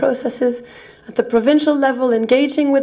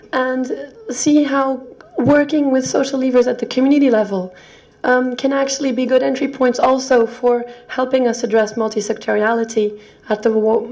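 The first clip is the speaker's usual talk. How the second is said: usual speaking voice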